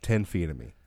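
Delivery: normal talking voice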